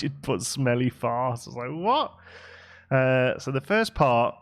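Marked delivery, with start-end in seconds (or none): 1.7-2.1 high, louder, rising "What?"; retold reaction of startled disbelief; incredulous what. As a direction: act out a baffled double take. Acts out own puzzled reaction.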